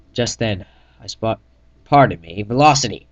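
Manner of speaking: usual speaking voice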